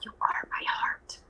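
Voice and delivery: breathy little whisper, no voice at all; child's whisper. Retold or imitated. Teacher quoting a toddler's whisper, soft whisper. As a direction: whisper it like a tiny girl sharing a secret. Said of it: "one of them just goes".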